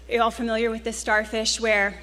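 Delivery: usual speaking voice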